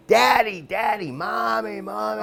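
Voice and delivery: high, loud crying calls for his parents; retold child's screams. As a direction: scream for daddy and mommy like a hurt, terrified kid. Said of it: "and I'm fucking screaming".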